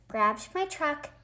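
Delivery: ordinary delivery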